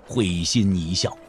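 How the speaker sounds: ordinary delivery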